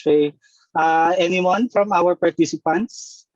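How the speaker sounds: ordinary talk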